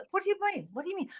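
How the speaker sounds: high tough South Boston accent; playing a tough Southie character. Shown as a demonstration. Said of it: "with a South Boston accent".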